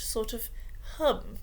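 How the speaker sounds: ordinary delivery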